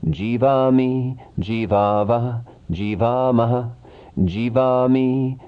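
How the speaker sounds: even, measured chanting of verb forms; rhythmic recitation. Shown as a demonstration. Teacher reciting Sanskrit verb forms for students, in a measured chant. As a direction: recite each form in a steady rhythm, like a class drill.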